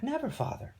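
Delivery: very high, shocked daughter; high-pitched, aghast denial. Acted. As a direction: protest in shock to your father. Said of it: "Danae recoiled in shock".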